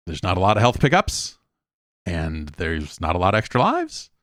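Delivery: normal talking voice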